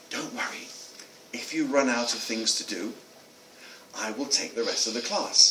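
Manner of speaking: very soft, low and measured; calm a panicked colleague almost in a whisper; hushed reassurance. Retold or imitated. Author to teacher without power for her cassette, hushed reassurance.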